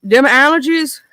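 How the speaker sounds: usual speaking voice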